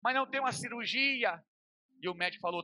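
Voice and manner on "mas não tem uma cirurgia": high, pleading imitation of crying; imitated tearful father. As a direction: plead with the doctor as a weeping father. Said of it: "Meu pai começou a chorar e falou". (imitando pai choroso, voz aguda)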